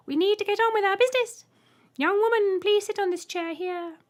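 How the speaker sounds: very high, loud piercing voice; Trembler. A narrator's reading. Alien greeting the human, piercing high voice. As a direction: squeak it out politely.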